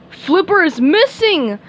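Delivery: blustering captain shout. A narrator's reading. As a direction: roar the bad news. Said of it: "shouted Mister Krabs".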